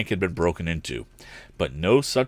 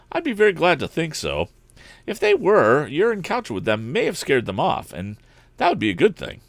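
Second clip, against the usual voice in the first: lightly voiced young man, higher; a bright, cheerful lad, pitched up; higher young hero voice, eager; eager young inventor; 8 semitones higher than usual. A narrator's reading. Narrator voices Tom.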